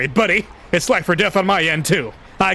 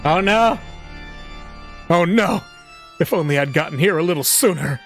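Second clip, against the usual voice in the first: anguished lament; 6 semitones higher than usual. A narrator's reading. Grief at the scene.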